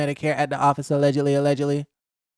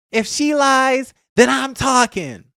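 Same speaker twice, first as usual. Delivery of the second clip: Simone's screaming voice; 8 semitones higher, slower than usual. Retold or imitated. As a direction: belt it out like she's yelling over everyone. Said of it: "Can't even do the Simone voice".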